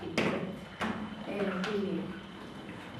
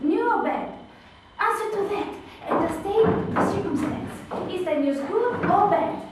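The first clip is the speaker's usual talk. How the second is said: eager Juliet, higher and louder, pleading for the news; impatient Juliet; 10 semitones higher, 10 dB louder than usual. Acted. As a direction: beg the nurse for an answer, bursting with impatience. Juliet pressing the nurse.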